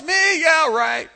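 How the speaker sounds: loud, high-pitched incredulity; scoffing disbelief. Acted. Doubter answering God's praise, loud scoffing.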